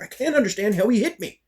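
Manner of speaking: high-pitched exasperated retort; retold worked-up protest; mad, exasperated. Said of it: "Why are you mad?".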